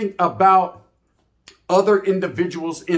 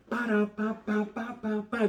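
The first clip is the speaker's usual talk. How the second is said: soft imitated machine-gun burst; mock gunfire; 4 semitones higher, 9 dB quieter, slower than usual. Imitating a drive-by shooting, soft gunfire rattle.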